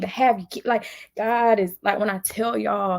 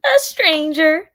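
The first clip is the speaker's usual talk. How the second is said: high, loud, slow, drawn out; A stranger, stretched out high and loud; emphatic outburst; 9 semitones higher, 7 dB louder, much slower than usual. Real, not acted. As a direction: land the word like a slap of disbelief. Incredulous punchline to rhetorical question.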